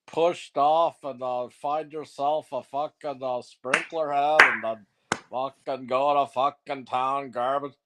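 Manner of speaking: normal talking voice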